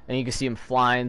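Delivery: ordinary talk, nothing marked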